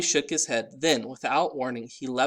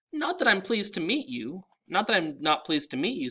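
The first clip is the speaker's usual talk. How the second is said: quickly; 6 semitones higher than usual. A narrator's reading. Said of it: "said Mister D quickly".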